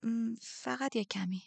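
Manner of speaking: hesitant, effortful reply. Acted. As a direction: push the words out reluctantly. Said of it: "با زحمت گفتم".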